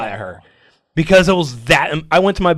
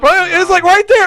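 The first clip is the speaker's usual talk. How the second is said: very high and loud; nearly tearing up, voice pushed up; emotional outburst; 7 dB louder than usual. Real, not acted. Telling friends he nearly cried, very high and loud.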